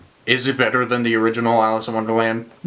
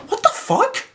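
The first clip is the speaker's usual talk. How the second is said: much higher, disbelieving what the fuck; incredulous outburst. Real, not acted. Reviewer reacting to the werewolf reveal, much higher in disbelief. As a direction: squeak it out in disbelief. Reacting to absurd plot twist.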